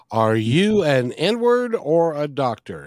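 slow, deliberate title announcement; slow title read. Real, not acted. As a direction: spell out the title slowly. Announcing the book title again.

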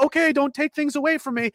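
high, whiny mock surrender; lightly mocked timid voice; meek caving. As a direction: plead like a scared child. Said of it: "Instead, they went".

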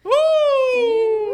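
loud, high party whoop; excited whoop. Real, not acted. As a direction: let out a big cheer. Celebrating a shared joke.

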